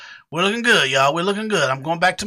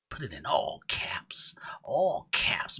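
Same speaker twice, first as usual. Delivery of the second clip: unvoiced whispered aside; 7 semitones lower, 10 dB quieter, slower than usual. Real, not acted. Streamer whispering to himself while typing. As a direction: whisper it to yourself.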